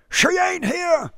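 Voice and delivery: high, loud choked wail; choked cry. Acted. Father cries to the suitor that his daughter is gone. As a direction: cry it out, voice catching. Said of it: "Rochford cried in a choked voice".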